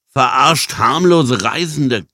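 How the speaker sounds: high, slow accusation, a touch winded; exhausted panting. Acted. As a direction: accuse them while running out of steam. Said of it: "keuchte Jan erschöpft".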